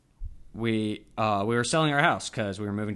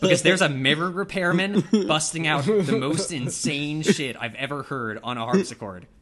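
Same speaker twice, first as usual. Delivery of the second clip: urgent excitement; 2 semitones higher, 6 dB louder than usual. Retold or imitated. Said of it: "texting my mom, like".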